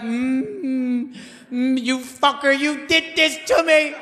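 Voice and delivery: high, slow groaning curse mid-push; straining wife. Retold or imitated. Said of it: "She was like".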